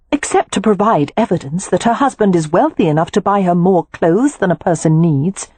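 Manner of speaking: lightly voiced Poppy, rolling her eyes; exasperated young wife. Poppy rants about frocks.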